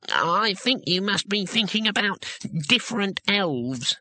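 high gnome voice, slowing down; slowly. A narrator's reading. Gnome correcting a naive tourist, slow and careful. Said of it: "the gnome said slowly".

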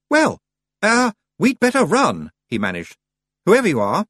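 put-on high, loud flustered boy; stammering, unsettled; nervous lad. Roland tries to rescue the strange girl, flustered.

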